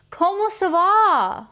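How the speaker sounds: high, loud, stretched "comment ça va"; sing-song hello to the ducks; bright, high, loud French greeting; excited greeting. Pretend. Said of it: "greets in French".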